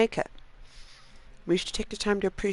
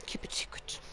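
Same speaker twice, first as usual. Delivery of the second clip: secretive whispered warning; 17 dB quieter than usual. Retold or imitated. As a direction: breathe it like a wizard's secret. That's the reference.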